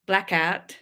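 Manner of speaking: another light go at Ben's Australian Blackout; mock Australian accent. Retrying the friend's Australian word in a faint Australian accent. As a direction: Blackout with Australian vowels, barely shifted. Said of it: "Trying to do an Australian accent".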